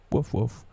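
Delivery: playful barking woof; mock woof. Lone wolf self-pity, mock dog barks. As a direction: bark like a dog.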